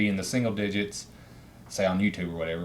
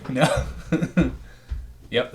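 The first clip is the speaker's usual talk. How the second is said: ordinary talk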